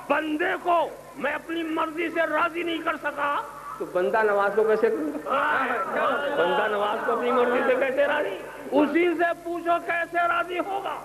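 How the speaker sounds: rising pitch ending in a shout; preaching building to shout. Acted. Preacher challenging congregation, building to a shout. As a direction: build the question until you cry out.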